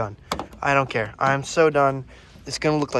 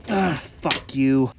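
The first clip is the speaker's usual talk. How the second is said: strained curse; 5 dB louder, slower than usual. Real, not acted. Straining against a tight part.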